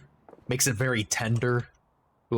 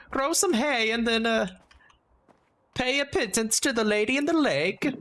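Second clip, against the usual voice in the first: high, scornful put-on voice; a hammed-up, much higher snob; mock snooty voice; 10 semitones higher than usual. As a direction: sneer down at the peasants, pitched up. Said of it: "It's like".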